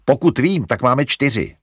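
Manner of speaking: a fed-up brother complaining; exasperated. A narrator's reading. Larry to mother about the dogs, exasperated. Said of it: "zaupěl Larry".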